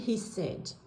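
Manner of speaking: ordinary delivery, nothing marked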